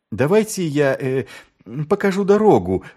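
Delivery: high, eager offer of help; obliging. A narrator's reading. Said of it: "услужливо предложил Маркоу".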